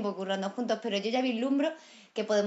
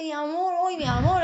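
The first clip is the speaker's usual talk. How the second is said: put-on higher, playful Cuban accent; mock the Cuban twang you picked up in Havana; lightly put-on Cuban lilt; 5 semitones higher, 4 dB louder than usual. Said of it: "así que dice".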